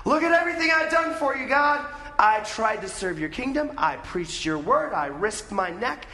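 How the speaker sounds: high, loud, fast outburst easing slightly; mock temper tantrum. Elijah listing all he's done for God, mock tantrum. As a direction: throw a hammed-up fit of grievance. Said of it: "like he's throwing a temper tantrum".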